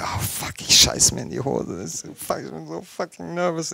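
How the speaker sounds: whispered, jittery nerves before the premiere; imitating a panicking colleague in a whisper; nervous whisper. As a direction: hush it like you're scared stiff. Daniel Brühl nervous in car.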